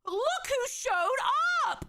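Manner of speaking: high, slow teasing announcement; mock dramatic surprise. Real, not acted. Watcher greets a surprise guest on screen, mock dramatic surprise. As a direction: draw it out like a big reveal.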